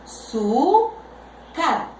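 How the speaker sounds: "Azúcar" very slowly in three pieces: a, zú, car; split into syllables. Shown as a demonstration. Teacher separating a word for pupils, "Azúcar" split syllable by syllable. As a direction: pause between each syllable of "Azúcar". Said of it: "Separo en sílabas".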